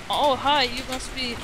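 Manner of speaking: ordinary delivery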